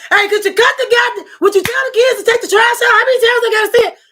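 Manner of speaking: high, loud, fast yelling about the trash. Retold or imitated. Wife fed up, yelling at her man about the trash. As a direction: blow up after asking too many times. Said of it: "Then we raise our voice".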